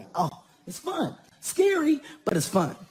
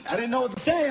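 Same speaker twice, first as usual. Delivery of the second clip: ordinary talk, nothing marked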